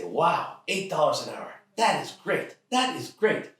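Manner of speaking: high-pitched, exaggerated dad enthusiasm; mock amazement. Dad hearing of friend Matt's wage, mock-impressed. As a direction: marvel with overdone admiration.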